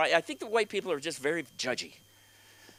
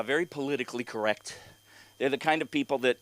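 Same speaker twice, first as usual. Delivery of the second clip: normal talking voice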